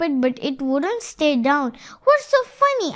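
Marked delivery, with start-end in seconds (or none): 2.0-2.9 high, indignant bear; puzzled, put-out storybook bear; frowning question. A narrator's reading. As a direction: ask with a frown, pitched well up. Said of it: "asked the bear with a frown".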